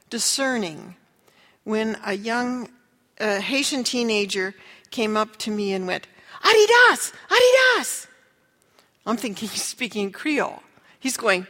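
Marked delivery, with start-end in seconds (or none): 6.4-8.1 high, loud excited calling out; excited shout. Retold or imitated. Haitian teen calling out to the missionary, loud and high. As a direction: call out eagerly to grab attention.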